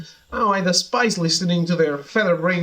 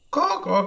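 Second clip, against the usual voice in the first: bird caws, pitched up; high caws; 7 semitones higher than usual. Acted. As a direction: caw like a crow, high and bright. Birdman caws.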